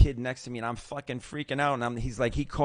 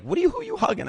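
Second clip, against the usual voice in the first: put-on confused kid, pitched way up; high puzzled voice; 4 dB louder, faster than usual. Kid asks who he's hugging, high and baffled. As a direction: imitate the baffled kid beside him. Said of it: "the kid that was with me was like".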